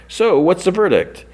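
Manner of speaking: a lightly put-on TV show host, asking for the verdict; high reviewer voice. Recapping the episode, voicing the TV reviewer in a high mock host voice.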